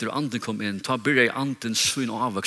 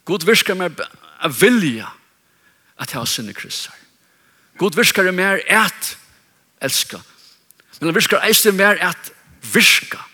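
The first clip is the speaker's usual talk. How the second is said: raised pitch, loud punched key words; emphatic preaching; 6 semitones higher, 5 dB louder than usual. Real, not acted. Preacher explaining to congregation how God's Spirit works, emphatic preaching. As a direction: drive the teaching home forcefully.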